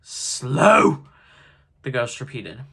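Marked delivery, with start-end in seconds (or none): 0.0-1.1 loud, rising incredulous question; swelling ghostly disbelief; indignant echo. A narrator's reading. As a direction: throw the word back in outrage. Ghost indignantly repeats Scrooge's word.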